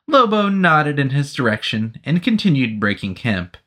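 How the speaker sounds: ordinary delivery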